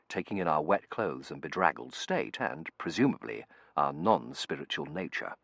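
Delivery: ordinary delivery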